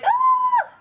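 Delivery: very high, loud shriek of delight; squeal of joy. Retold or imitated. All the newly hired girls cheering, shrieking with joy. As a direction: shriek like you just got the job. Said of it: "we were so happy".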